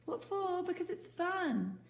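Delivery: incredulous. Acted. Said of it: "boy astonished by question".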